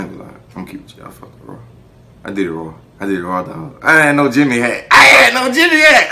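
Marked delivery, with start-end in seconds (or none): 3.8-4.9 emphatic. Shown as a demonstration. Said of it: "repeats the line". 4.9-6.1 "I had no Jimmy hat" yelled much higher and louder; hammed-up high-pitched yell. Shown as a demonstration. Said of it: "repeats the line".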